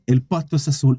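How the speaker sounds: low-pitched, falling lion reply; lion's verdict. Acted. Lion answering the donkey, low-pitched verdict. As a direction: grant it low, as the king. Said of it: "el león le queda mirando y le dice".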